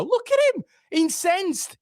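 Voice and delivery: very high-pitched mocking outburst; gleeful jeer. Real, not acted. Fan pointing out the incensed player, high-pitched jeering. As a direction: crow at the rattled player, pitched right up.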